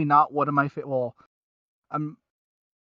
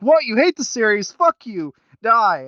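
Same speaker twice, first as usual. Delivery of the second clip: raised, louder mock outrage at a hater; a hammed-up furious fan, higher and louder; mock angry fan; 7 semitones higher, 5 dB louder than usual. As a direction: play an outraged superfan yelling at a critic. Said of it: "one of our five fans is gonna be like".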